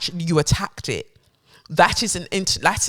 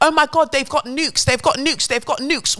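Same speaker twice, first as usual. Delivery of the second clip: high, fast alarmed cry about nukes; alarmed exclaiming; 9 semitones higher, faster than usual. Real, not acted. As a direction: sound the alarm, repeating it.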